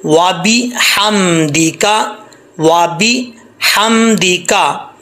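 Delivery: वा बी हम दी का split into slow syllables; slow syllable drill. Shown as a demonstration. Teacher drills the syllables slowly. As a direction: spell out वा बी हम दी का slowly. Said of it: "हा ज़बर मीम".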